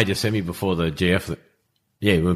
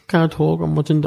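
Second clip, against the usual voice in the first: high, strained mock-tearful mum; retold tearful voice, much higher; imitating tearful mum; 11 semitones higher than usual. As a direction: put on an upset mum, pitched well up. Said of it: "Mum's in tears".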